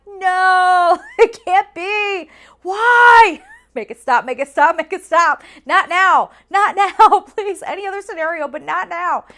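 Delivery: high, loud desperate begging; panicked pleading. Retold or imitated. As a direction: beg the plane to stop. Panic as turbulence hits bathroom.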